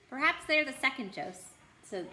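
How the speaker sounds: plain reading voice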